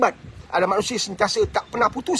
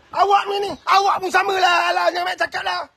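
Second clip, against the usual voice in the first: dismissive husband; 9 semitones higher than usual. Retold or imitated. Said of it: "Suami kata".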